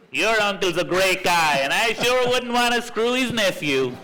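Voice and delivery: put-on American accent. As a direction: put on a brash American car dealer's accent. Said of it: "Say this with an American accent".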